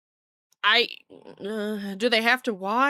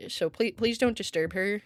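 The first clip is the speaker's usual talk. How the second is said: ordinary talk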